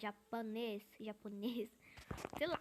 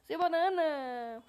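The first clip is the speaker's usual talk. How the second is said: slow, much louder "Sou o Banana!"; proud shout; 2 semitones higher, 12 dB louder, slower than usual. Real, not acted. Gamer telling the other player who he is, slow proud shout. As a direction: announce your name to the whole server. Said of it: "Eu sou o Banana".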